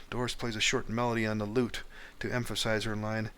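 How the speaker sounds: ordinary talk, nothing marked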